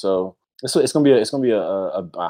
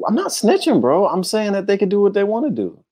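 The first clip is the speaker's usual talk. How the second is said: mock defensive; 12 semitones higher than usual.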